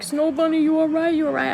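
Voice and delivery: high-pitched worried questions; worried asking. Retold or imitated. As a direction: ask anxiously if she's okay. Mike worried about her.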